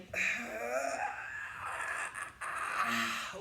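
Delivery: long, rough exhale of effort; strained exertion breath. Real, not acted. Coach working against the towel, straining exhale. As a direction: push the breath out through the strain.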